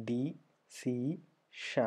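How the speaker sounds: ഡി, സി, ഷ as three separate beats; syllable by syllable. Shown as a demonstration. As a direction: pause after each of dee, see, sha. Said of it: "determination നമ്മൾ പറഞ്ഞു".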